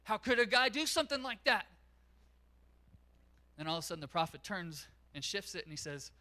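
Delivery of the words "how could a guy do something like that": higher, louder indignant protest; David fuming at the prophet's story; irate outburst. Retold or imitated. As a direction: blow up at the injustice. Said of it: "And David gets irate".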